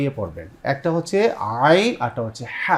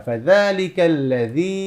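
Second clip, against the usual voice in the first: slow recitation demo; much slower than usual. Shown as a demonstration. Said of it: "কয় আলিফ টেনে পড়তে হয়?".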